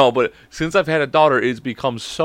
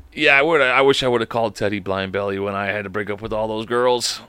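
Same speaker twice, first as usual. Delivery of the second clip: ordinary delivery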